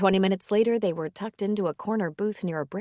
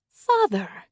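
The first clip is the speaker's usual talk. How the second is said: exclaimed; 5 semitones higher, 6 dB louder than usual. Acted. She exclaims at the priest.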